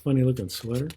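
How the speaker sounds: usual speaking voice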